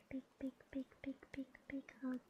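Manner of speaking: rhythmic, hushed, fading to a whisper; soft whispery repetition. Pretend. Streamer giving listener the biggest hug, whispery.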